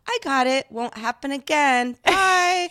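a breezy, teasing sign-off; sly brush-off. Retold or imitated. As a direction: wave her off with a sly grin. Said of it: "I kind of smiled slyly".

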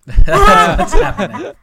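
loud laughing punchline. Real, not acted. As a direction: laugh it out slowly.

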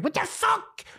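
high, startled squeal of disbelief; shocked outburst. Retold or imitated. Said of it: "I was like".

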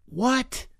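a drawn-out, baffled "What the fuck?", then a high squeaked "What?"; stunned disbelief. Retold or imitated. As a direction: sell total confusion at the compliment. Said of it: "And I was like".

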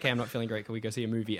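soft, low, mopey plea; whiny Hawkeye. Retold or imitated. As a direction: sulk like a sad friend. Whiny Hawkeye asking for company.

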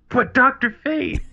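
high, drawn-out excited plea; excited fan. Retold or imitated. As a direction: squeal it like a giddy fanboy. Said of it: "was so excited".

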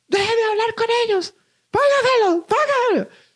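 high, shrill imitation of a mother ordering; demanding mom. Mother demanding to talk to the police, high mock voice. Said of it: "me dice".

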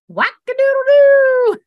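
high, loud, drawn-out whoop; hammed-up high-pitched hoot; zany mock whoop. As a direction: sing it out long and loud, like a cartoon loon call. Quoting own dramatic interview outburst.

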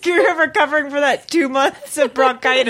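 high giggly talk; laughing. Real, not acted. Teasing each other, still laughing.

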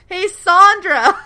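high, loud, drawn-out holler; imitated calling out. Man summoning Sandra to the phone, imitated holler. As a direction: yell her name slowly across the house.

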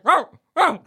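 high, loud "Row, row" bark; man stuck in a dog's body tries to talk, mock barking. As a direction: bark the words like a dog. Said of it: "it'll just come out as like".

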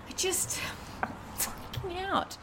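breathless whispered panic; panicked whisper. Acted. Frightened woman tells the couple she is freaking out, whispered. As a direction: whisper it like fear has stolen your voice.

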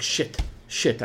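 under-the-breath whisper; whispered dismay; whispered curse. Retold or imitated. Retold quiet dismay.

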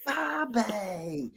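high, drawn-out mock London call; common London accent. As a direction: imitate mum hollering in broad London. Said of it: "She sounded like a right London commoner".

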